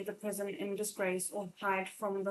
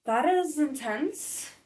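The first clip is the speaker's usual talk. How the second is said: taken aback; 5 semitones higher, 6 dB louder, slower than usual. Real, not acted. Reader reacts to the story.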